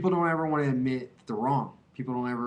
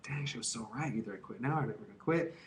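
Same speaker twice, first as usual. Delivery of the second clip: whispered inner thought, quick; 10 dB quieter, faster than usual. Retold or imitated. Remembering the mom's advice, whispered inner thought. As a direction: whisper it to yourself as a thought in your head. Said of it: "I kept thinking".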